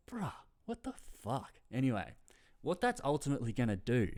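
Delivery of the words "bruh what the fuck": mock disbelief. Disbelief at reporter's question.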